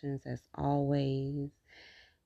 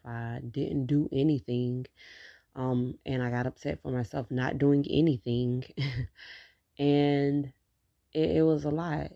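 ordinary delivery

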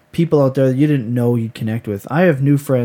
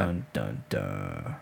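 deep, falling suspense sting; dramatic dun dun dun; 15 dB quieter, much slower than usual. Pretend. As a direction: sing a mock suspense sting. Mock suspense after forgotten point.

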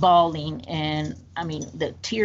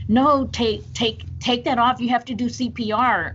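higher, urgent operator voice; operator insisting; 6 semitones higher than usual. Retold or imitated. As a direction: press her to obey at once.

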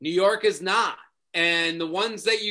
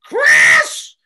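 shouted name, much lower and louder; angry yell; 12 dB louder than usual. Retold or imitated. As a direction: bellow the name across the pool. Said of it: "And she's like".

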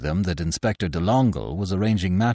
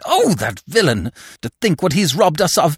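outraged wife railing in a wail; 6 semitones higher than usual. A narrator's reading. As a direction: rail bitterly, voice swooping from high. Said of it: "railing against the inspector".

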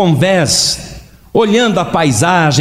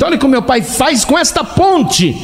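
menino encantado; 8 semitones higher than usual. Acted. Menino admirando o pai.

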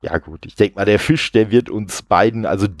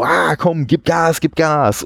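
higher-pitched pretend urging; mock egging on; 6 semitones higher than usual. Podcaster voicing the frantic game music, mock egging on. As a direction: imitate music whipping someone up. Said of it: "das ist jetzt nur noch Aufpeitschen".

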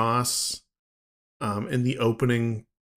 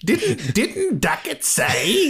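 much higher, slow, overdone Australian; hammed-up Australian accent; 12 semitones higher, much slower than usual. Repeating the character's question to a co-host in a hammed-up Australian accent. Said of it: "huge air quotes Australian accent".

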